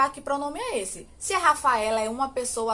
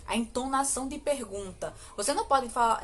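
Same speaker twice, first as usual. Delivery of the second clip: usual speaking voice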